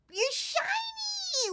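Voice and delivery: very high, slow disbelieving squeal; shocked squeal. Real, not acted. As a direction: shriek at the rare shiny find. Finding a shiny animal.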